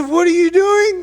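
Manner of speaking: very high, loud mock-mom demand; exasperated mom. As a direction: act out an alarmed mother demanding answers. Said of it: "She was pretty angry".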